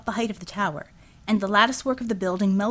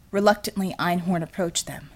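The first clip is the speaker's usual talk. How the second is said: usual speaking voice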